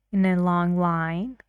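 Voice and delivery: "In a long line" with the voice rising on "line". Shown as a demonstration. Teacher demonstrating a friendly suggestion, rising tune. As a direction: lift "line" up to sound friendly. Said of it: "His voice goes up".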